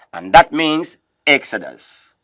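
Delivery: usual speaking voice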